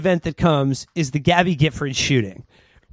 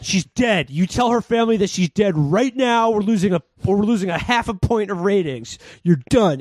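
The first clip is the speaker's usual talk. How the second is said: retold angry boss; 4 semitones higher than usual. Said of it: "who's like".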